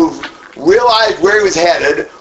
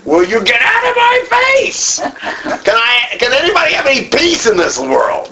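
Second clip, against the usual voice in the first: high, sharp, fed-up snap; exasperated outburst; 9 semitones higher than usual. Acted. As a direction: blurt it out, totally fed up. Acting out an exasperated reaction.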